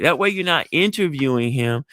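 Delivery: normal talking voice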